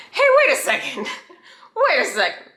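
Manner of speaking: high-pitched, laughing retold double-take; mock dawning suspicion. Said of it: "I was like".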